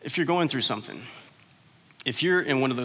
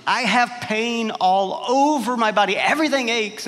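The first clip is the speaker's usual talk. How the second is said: whiny patient; 8 semitones higher, 5 dB louder than usual. Acted. Patient joke voice.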